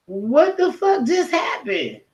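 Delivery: slow, drawn-out retold shock; stunned disbelief. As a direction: replay the moment of confusion. 'Cause I was like.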